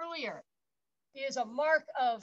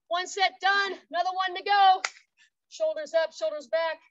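loud energetic workout cues; coach shout; 4 semitones higher, 9 dB louder than usual. Real, not acted. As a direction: pump up the class.

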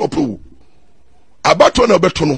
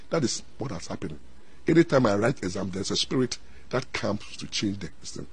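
much softer, low and hushed; a student quietly admits a spirit changes her exams, hushed; hushed confession; 10 semitones lower, 13 dB quieter than usual. Retold or imitated. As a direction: let the student's confession sink to a near murmur.

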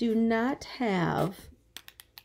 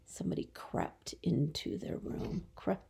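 whispered sentence, no voice; soft whispered example; 2 semitones lower, 10 dB quieter than usual. Shown as a demonstration. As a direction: breathe it out quietly. Teacher models sentence softly.

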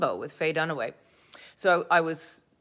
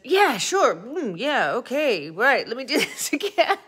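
much higher, louder, swooping mock reply; hammed-up eager agreement; 8 semitones higher, 7 dB louder than usual. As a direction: overplay the keen actor agreeing to another take, breaking into a laugh. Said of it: "So I said".

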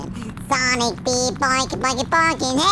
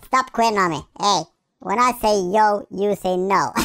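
ordinary talk

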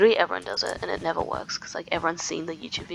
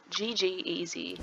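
usual speaking voice

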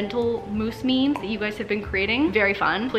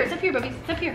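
soft coaxing; 5 semitones higher, 7 dB quieter, much faster than usual. Real, not acted.

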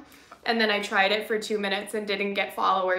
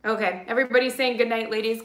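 ordinary talk

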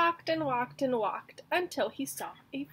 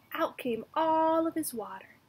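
usual speaking voice

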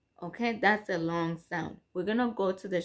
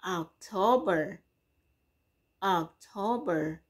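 slow demo word. Shown as a demonstration. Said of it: "as in".

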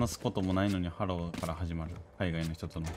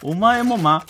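loud shout; 14 semitones higher, 14 dB louder than usual. Real, not acted. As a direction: yell it out loud. Shouting at a friend.

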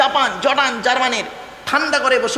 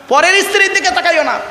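emphatic repeat; 7 semitones higher, 4 dB louder than usual. Acted. Repeated quoted command, emphatic.

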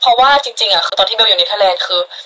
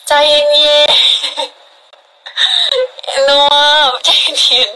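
laughing plea; 6 semitones higher, much slower than usual. Real, not acted.

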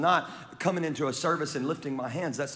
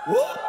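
very high shouted whoop; Aggie whoop; 5 dB louder than usual. Retold or imitated. Preacher shouts an Aggie whoop at the crowd. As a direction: belt out a fan's whoop. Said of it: "Any Aggies here?".

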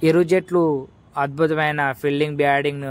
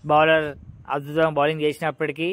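ordinary delivery

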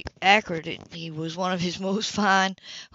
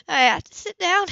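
high, louder urgent plea; pleading before she collapses; desperate plea; 11 semitones higher, 6 dB louder than usual. A narrator's reading. Woman near fainting begging to sit, desperate.

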